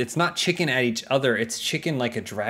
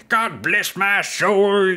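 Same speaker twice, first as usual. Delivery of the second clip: put-on higher, louder, very slow old man voice; 9 semitones higher, 4 dB louder, much slower than usual. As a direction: exclaim like a wacky old movie geezer. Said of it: "old men who are playing minors".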